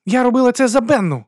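high, loud outburst; angry shout. A narrator's reading. Woman tells the duke it was for Benna, shouting. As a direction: fling it out at full pitch. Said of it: "крикнула вона".

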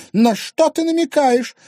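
high, loud indignant question; affronted challenge. Acted. Wife challenging husband's insinuation, affronted.

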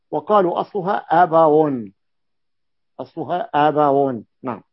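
usual speaking voice

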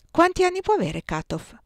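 ordinary delivery, nothing marked

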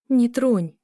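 short, wounded warning; pained rebuff. A narrator's reading. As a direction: push him away with pain in the voice. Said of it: "наполненная болью".

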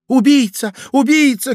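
high, loud accusing cries; lady screaming. A narrator's reading. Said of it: "кричала дама". (пронзительный крик дамы)

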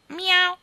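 high, loud sly meow; cat teasing the king with a meow; mischievous meow. Acted. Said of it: "disse con malizia".

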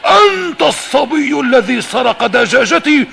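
very high, loud furious bellow; thundering shout. Acted. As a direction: roar the accusation at the thief. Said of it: "فقال له مرعدا".

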